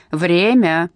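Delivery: loud barked call of time; barked order. Acted. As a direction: bark it like a prison guard. Guard calling time again.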